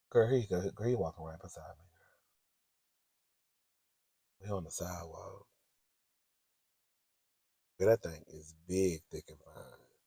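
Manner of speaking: low, soft confidential murmur; hushed aside. Real, not acted. To a girlfriend as the man walks beside her, hushed aside. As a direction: keep it under your breath.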